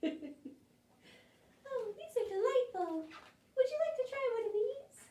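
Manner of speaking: very high, fast, giggly dainty voice; sweet pumpkin. Acted. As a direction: offer the cakes daintily. Chris offers fairy cakes.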